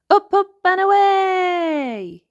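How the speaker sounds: high, loud, slow joyful call; excited cry. Acted. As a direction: launch the ride with glee. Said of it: "he cried".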